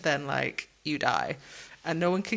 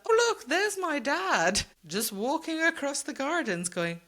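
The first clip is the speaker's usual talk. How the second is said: lightly acted naive delight, higher; high, drawn-out mock wonder; girl spotting dad; 7 semitones higher than usual. Voicing the girl seeing her dad.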